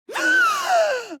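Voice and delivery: loud, high gasp of shock; sharp gasp. Acted. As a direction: gasp hard, as if jolted. Jolted by a sudden shock.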